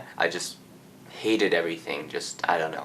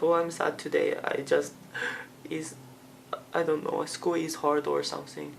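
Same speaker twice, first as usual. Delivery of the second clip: high, small mock-tearful voice; halting, hammed-up sad whimper, pitched way up; imitated crying; 10 semitones higher, 4 dB quieter than usual. Crying student makes excuses to the security officer, imitated tearful voice. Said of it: "I'm just crying".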